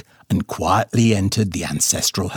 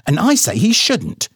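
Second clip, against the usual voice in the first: mama's voice; 8 semitones higher, 3 dB louder than usual. A narrator's reading. Mama argues back at daddy.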